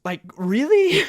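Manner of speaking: high, loud incredulous "really?"; exasperated disbelief. Real, not acted. Griping to viewers about the game's unfair twist, loud disbelief. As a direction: throw up your hands in loud disbelief.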